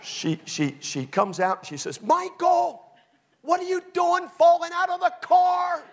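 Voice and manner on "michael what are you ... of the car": loud, high scolding mom; shrill-pitched mock holler; mother hollering. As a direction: imitate an exasperated mother yelling at her kid. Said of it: "She's hollering at my brother".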